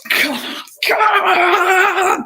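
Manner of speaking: building to a high, drawn-out yell; real fan's yell; urging shout. Real, not acted. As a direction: urge the team on, voice soaring. Urging his team on.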